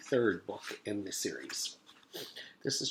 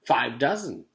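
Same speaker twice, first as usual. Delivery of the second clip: proud; 4 semitones higher, 8 dB louder than usual. Acted. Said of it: "Joe said proudly".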